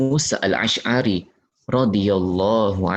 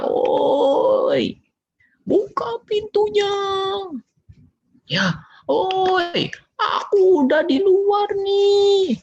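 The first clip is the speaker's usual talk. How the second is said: put-on an impatient child shouting to be let in; shouting at the door; slower than usual. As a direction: yell at the closed door. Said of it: "langsung teriak".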